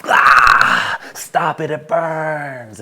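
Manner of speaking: a loud, crying wail of pain; pained depression voice. Retold or imitated. His depressed side wailing at self-love, loud and crying. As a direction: cry out as if the light burns. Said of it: "the depressive and suicidal part of me was like".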